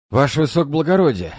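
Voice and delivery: panting, breathless report; out of breath. Acted. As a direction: gasp it out, out of breath. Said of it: "запыхавшись".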